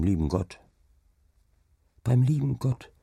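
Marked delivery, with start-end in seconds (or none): 2.0-2.9 put-on a lightly higher child's voice, stress on Beim; child repeating. Child repeating the father's words, lightly higher and wondering. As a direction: echo "Beim lieben Gott" like a wondering child. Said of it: "wiederholte das Kind".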